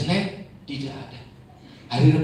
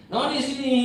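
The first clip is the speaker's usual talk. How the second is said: father calls his daughter a second time, higher; 12 semitones higher than usual. Retold or imitated. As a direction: call her again, more insistent. Calling again, higher.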